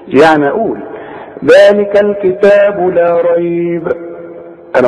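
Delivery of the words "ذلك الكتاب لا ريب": slow chant halting on ريب; stop after ريب. Shown as a demonstration. Teacher shows students the first stop, pausing after ريب. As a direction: recite slowly and pause right after ريب. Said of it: "وقفت على ريب ما تقفش على فيه".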